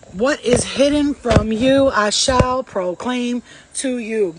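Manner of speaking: slow, loud, measured proclamation; solemn quote. Acted. Quoting Mary to viewers as a slow loud proclamation. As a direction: declaim each word slowly. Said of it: "Mary, quote".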